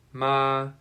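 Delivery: ma held high and level; a steady high flat ma; first tone ma. Shown as a demonstration. Teacher shows the first tone, high and level. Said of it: "first tone is high and sounds like".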